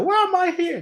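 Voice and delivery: high, bewildered mock question; hammed-up confusion, pitched high; puzzled whine. Quoting ourselves confused to spirit.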